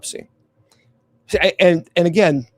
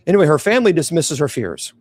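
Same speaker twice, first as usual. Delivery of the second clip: plain reading voice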